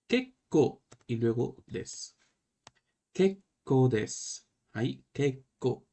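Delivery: "Kekko" said slowly with the doubled k held; slow model pronunciation. Shown as a demonstration. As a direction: stretch the pause on the double k of "Kekko". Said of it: "duplica la consonante". ("Kekko" despacio, marcando bien la doble k)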